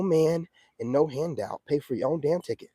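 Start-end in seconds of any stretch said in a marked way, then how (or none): none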